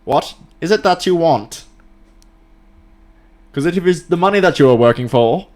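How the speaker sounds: booming lecture-hall sage voice; Alan Watts impression. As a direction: preach it like a grand philosopher on tape. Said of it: "Alan Watts".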